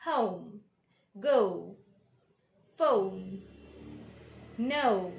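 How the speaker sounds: careful isolated words with falling tune; correct gliding O. Shown as a demonstration. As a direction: glide each O in home, go, phone, no towards "oo". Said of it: "This is the correct pronunciation".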